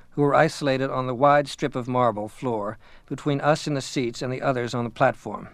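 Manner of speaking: usual speaking voice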